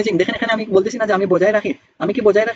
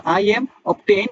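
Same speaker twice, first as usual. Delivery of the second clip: "I am obtain" spoken very slowly; wrong form, drawn out; much slower than usual. Shown as a demonstration. Teacher slowly shows a wrong example. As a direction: drag out "I am obtain" to show the mistake. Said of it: "তখন বললেন".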